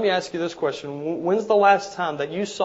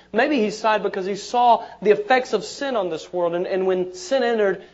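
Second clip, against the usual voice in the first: loud, fast pulpit build; rising fervent preaching; building sermon climax; 4 semitones higher, 7 dB louder than usual. Acted. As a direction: preach it up to a shout.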